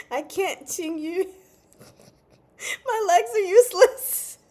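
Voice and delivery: high pitched, loud, cracking up mid-quote; can barely get the words out for laughing; laughing through it. Real, not acted. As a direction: quote dissolving into giggles. Quoting him, breaking into laughter.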